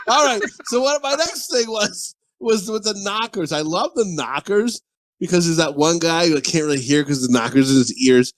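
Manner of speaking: ordinary talk, nothing marked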